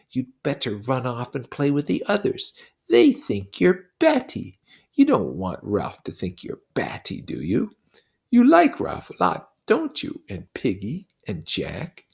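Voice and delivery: put-on a buzzing devil taunting a child; Lord of the Flies, mocking.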